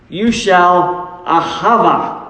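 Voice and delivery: "ahava" said slowly, a-HA-va, Hebrew word for love. Shown as a demonstration. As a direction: lay out "ahava" slow and deliberate. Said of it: "ahava love".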